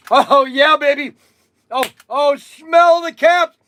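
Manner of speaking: high, loud cry of delight over the caps; ecstatic. Real, not acted. Sniffing cap smoke at the camera, ecstatic and loud.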